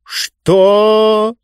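high, long drawn-out words; slow, high, imperious drawl; Mister Speaker drawling. A narrator's reading. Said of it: "медленно протянул мистер Спикер".

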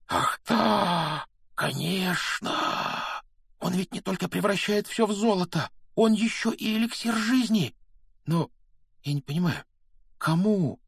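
put-on high, excited young Harry; excited realization. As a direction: blurt the discovery in a high-pitched rush of wonder. Harry suddenly realizes, then puzzles.

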